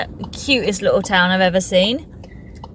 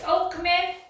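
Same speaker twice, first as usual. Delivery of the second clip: ordinary talk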